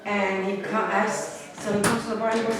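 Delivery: ordinary talk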